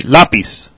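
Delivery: Lápiz stressed on the first syllable, LA. Shown as a demonstration. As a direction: model Lápiz with the weight on the A. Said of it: "the stress when you say the word lápiz is on the A".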